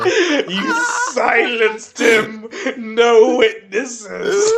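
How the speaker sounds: laughing shout. Real, not acted. Laughing horror at murder joke.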